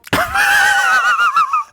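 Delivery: very high, loud shriek of laughter. Real, not acted. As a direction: let out a screaming laugh. Explodes laughing.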